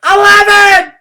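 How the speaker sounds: the whole class yells the number; shouted cheer. A narrator's reading. Room nine cheering William on, shouting. Class counting final pull-up.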